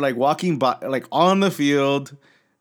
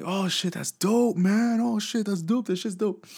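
high, hushed awe; quietly blown away, much higher; amazed friend; 8 semitones higher, 4 dB quieter than usual. Retold or imitated. Friend amazed seeing the players.

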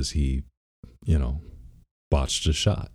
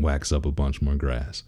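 ordinary talk

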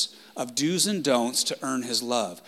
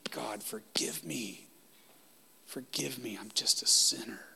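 a sinner begging quietly for mercy; humble whispered prayer; 8 dB quieter than usual. Retold or imitated. Voicing the humble tax collector.

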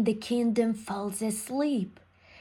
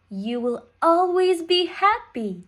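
lightly voiced fairy, pitched up; sixth fairy; 6 semitones higher, 4 dB louder than usual. A narrator's reading. Sixth fairy blesses the baby princess, lightly voiced and higher. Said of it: "says the sixth fairy".

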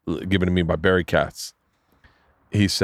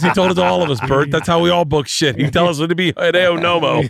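high, raised-voice mock exclamation; excited retelling; 8 semitones higher, 4 dB louder than usual. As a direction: retell it high and worked up through laughter. Comic retells colleague's excited punchline.

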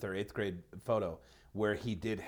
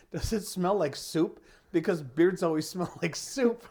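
lightly voiced girlfriend; 6 semitones higher, 4 dB louder than usual. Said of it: "and she said".

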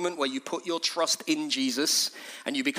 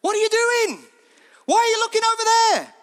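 very high, loud urgent shout; full-voiced cry of alarm, high and long; 7 dB louder than usual. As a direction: yell it like a street preacher. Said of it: "he's shouting exactly the same thing".

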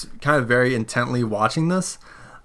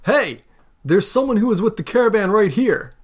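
high call across a crowd, not quite shouting; 9 semitones higher than usual. Acted. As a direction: announce it to the crowd, pitched high. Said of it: "puts his hands over his mouth".

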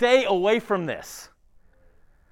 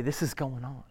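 much softer and lower, a close, caring word; the pastor quietly reassures a struggling brother, much softer and lower; gentle reassurance; 8 semitones lower, 5 dB quieter than usual. Pretend. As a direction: lean in and quietly tell a friend you're with him.

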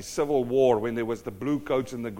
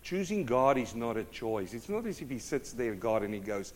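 usual speaking voice

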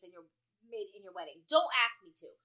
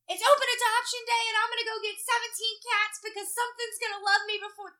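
high, insistent retold rant; mad rant; 7 semitones higher, 4 dB louder than usual. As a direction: replay your angry outburst, pitched up throughout. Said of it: "Like, I was mad".

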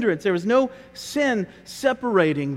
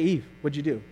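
softer, lower, muted question; quiet question; 4 semitones lower, 6 dB quieter, faster than usual. Pretend. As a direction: say it under your breath. Contrasting quiet re-reading.

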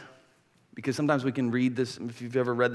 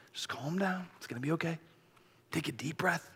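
very soft, calming voice; soothing reassurance; 3 semitones higher, 10 dB quieter than usual. Acted. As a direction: gently settle someone who is anxious. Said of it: "we need to do that for each other".

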